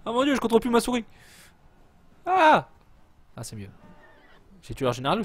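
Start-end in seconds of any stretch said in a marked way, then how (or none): 0.0-1.0 high, loud and rushed; panicked outcry. Real, not acted. Player losing control of the mouse, panicked. 2.2-2.7 short, high, loud scream; sudden high yelp of alarm; panicked yelp. Real, not acted. Player struggling with a lagging game, yelping. Lag, loses control of mouse.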